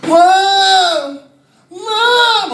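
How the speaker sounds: high, loud drawn-out wail; child calling for mother. Retold or imitated. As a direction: imitate a stuck kid wailing for help. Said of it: "he starts calling for his mother".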